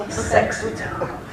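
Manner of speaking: soft, slow, breathy-free sexy murmur; mock seductive. Teasing the audience about the night, soft and slow. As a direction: purr it like a cheeky tease.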